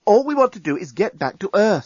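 lightly voiced Nettie. A narrator's reading. Nettie's line in story.

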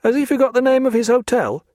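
a plain man guessing aloud; puzzled guard. A narrator's reading. Guard asks the others about Stephen, puzzled. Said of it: "suggested one".

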